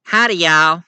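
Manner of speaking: big friendly Southern howdy; Rogue's Southern drawl. Acted. Rogue greeting the newcomer in a Southern accent. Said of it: "This here is Rogue".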